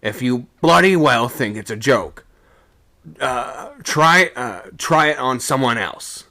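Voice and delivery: a seaman shouting in anger aloft; angry shout. A narrator's reading. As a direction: yell at the man below. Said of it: "he shouted".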